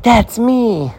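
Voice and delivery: high, gleeful squeal; seeing the happy kid on the package, a giddy high squeal; giddy delight. Retold or imitated. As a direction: beam like the jolly kid on the box. Identifies with jolly garlic bread kid.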